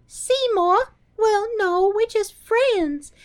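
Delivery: squeaky sweet Audrey impression; high breathy Audrey voice. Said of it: "Audrey Classic is like".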